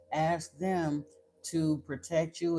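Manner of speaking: usual speaking voice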